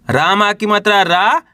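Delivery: slow, रा held with a rising tune; spelling aloud. Shown as a demonstration. Teacher spelling a word for learners, slow with stretched रा. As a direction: spell it out and lift the final रा. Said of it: "तो कैसे पढ़ेंगे".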